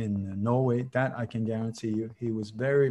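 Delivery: usual speaking voice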